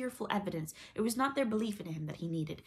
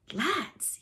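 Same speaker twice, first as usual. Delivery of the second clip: higher-pitched, slightly shaken address; 8 semitones higher, 4 dB louder than usual. A narrator's reading. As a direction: appeal to the crew, a little rattled. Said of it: "he said, shaking a little".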